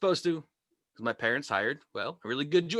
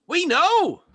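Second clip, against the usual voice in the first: exasperated; 10 semitones higher, 5 dB louder, much slower than usual. Acted. Comic's exasperated reply to chat.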